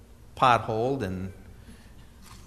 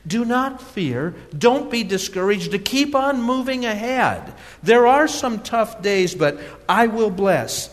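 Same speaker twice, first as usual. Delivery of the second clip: reads God's words louder and higher, preaching in full force; preaching scripture; 5 semitones higher, 5 dB louder than usual. Acted. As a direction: proclaim it like the Lord speaking to His people.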